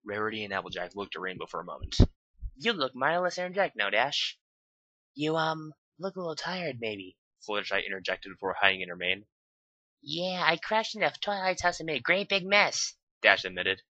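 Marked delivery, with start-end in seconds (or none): 2.5-4.4 much higher teasing voice; lightly voiced Rarity; Rarity's voice. 5.1-7.2 hesitant and shy, fading; a hint of shy voice; timid Fluttershy. 10.0-12.9 much higher character voice; high Dash voice; Dash's voice. Acted. Dash admits the mess.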